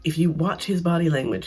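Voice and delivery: normal talking voice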